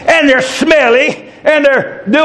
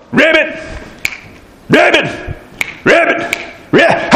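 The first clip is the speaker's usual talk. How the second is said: rhythmic croaked ribbits; frog voice; 3 semitones higher than usual. Retold or imitated. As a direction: croak it to a beat. Frogs croaking to a beat.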